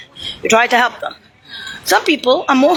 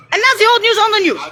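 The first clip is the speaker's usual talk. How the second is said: higher and quick, a scornful sing-song; higher, mocking repeat; 8 semitones higher, faster than usual. Repeating his own line to the camera, higher and mocking. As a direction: toss it off higher and faster, sneering.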